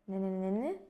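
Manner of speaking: hummed syllables sliding up at the end; wordless hum that lifts up at the end; rising intonation. Shown as a demonstration. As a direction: hum it with the pitch climbing to the finish. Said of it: "the voice rises towards the end".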